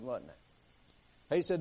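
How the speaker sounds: usual speaking voice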